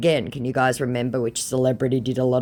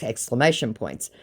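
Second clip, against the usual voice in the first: ordinary talk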